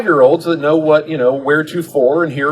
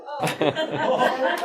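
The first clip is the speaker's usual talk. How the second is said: big laugh; 14 semitones higher, 6 dB quieter than usual. Real, not acted. Laugh at own misunderstanding.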